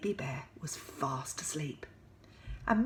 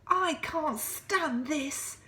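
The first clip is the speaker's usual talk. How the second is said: exasperated Mister Bear; 9 semitones higher, slower than usual. Acted. Mister Bear kept awake, slow high exasperated wail. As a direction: drag every word out in despair. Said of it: "said Mister Bear".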